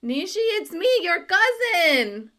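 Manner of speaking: acted excited call to a relative; excited cousin reveal. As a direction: plead to be recognised as family. IT agent acted, recognising his cousin.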